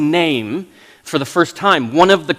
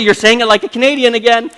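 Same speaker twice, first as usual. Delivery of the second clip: mock household scolding; 7 semitones higher, 6 dB louder than usual. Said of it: "we always say".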